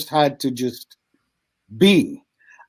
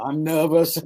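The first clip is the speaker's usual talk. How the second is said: normal talking voice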